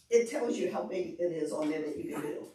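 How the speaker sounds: ordinary talk, nothing marked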